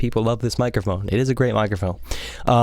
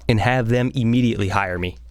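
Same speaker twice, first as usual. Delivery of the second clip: normal talking voice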